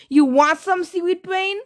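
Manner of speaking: loud, rising mocking dare; taunting challenge. A narrator's reading. Thalia taunting Percy with a spear, mocking. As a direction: goad him into fighting.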